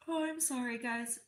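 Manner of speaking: mid-yawn apology. Real, not acted. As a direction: talk through a yawn. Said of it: "I'm yawning this much".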